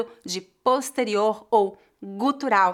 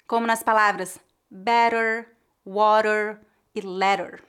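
ordinary talk, nothing marked

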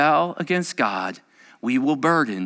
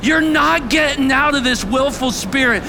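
high, loud, driving preaching; impassioned pulpit shout; preaching at full force; 13 semitones higher, 8 dB louder than usual. Real, not acted. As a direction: drive it home with raised intensity.

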